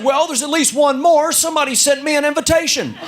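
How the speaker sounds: put-on a touch of an elderly man; lightly voiced old man. Old man answers in joke.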